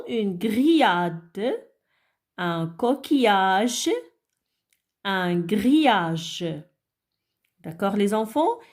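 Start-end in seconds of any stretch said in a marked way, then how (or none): 0.1-6.4 "grillade", "coquillage", "grillage" said very slowly; slow model words. Shown as a demonstration. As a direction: read each example word slowly for the class. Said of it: "Comment on peut lire le son I avec A". (exemples dits très lentement)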